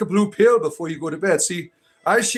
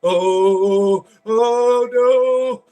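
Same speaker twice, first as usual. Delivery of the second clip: high, loud, hammed-up wailing with drawn-out vowels; wailing mock demon; 8 semitones higher, 4 dB louder, slower than usual. Demon begs Christians not to become Annie, mock wailing. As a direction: plead and howl like a cartoon demon being banished. Plays demon being cast out.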